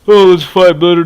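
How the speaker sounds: mock the other driver's loud challenge; mocking loud taunt. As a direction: jeer it back loudly. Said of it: "this dude's just like".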